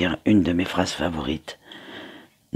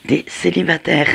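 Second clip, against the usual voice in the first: playful; 10 semitones higher, 6 dB louder than usual. Real, not acted. Teasing about singles' energies.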